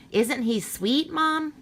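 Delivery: put-on high, pleading little kid voice; a child coaxing mom, voice rising; eager child.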